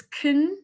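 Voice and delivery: "kin" with very little vowel, almost "kn"; reduced vowel. Shown as a demonstration. Teacher offering a way to say the syllable, "kin" reduced. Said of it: "So you could say".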